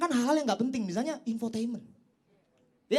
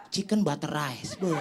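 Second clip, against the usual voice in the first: slow, lower, posh-food caption read; a slow, lowered mock foodie caption; mock fancy caption; 9 semitones lower, slower than usual. Mocking Instagram caption.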